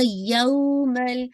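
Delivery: यौमल slowly, lam held without bounce; slow recitation demo. Shown as a demonstration. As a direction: say यौमल not यौमा. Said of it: "अच्छा यौमा नहीं पढ़ना".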